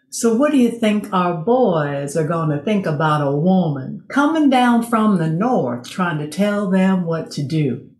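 a light Southern US accent, imitating the Atlanta interviewer; mock the interviewer's Southern accent, lightly done. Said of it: "He had a really strong Southern accent".

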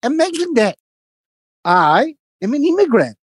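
high, slow boastful lecture; hammed-up proud immigrant man; mimicking the neighbour. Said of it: "He goes".

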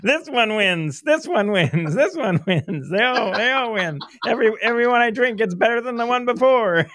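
high-pitched, gushing drinks judge; mock tipsy enthusiasm. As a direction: declare each winner with mounting joke delight. Acts out judge after many drinks.

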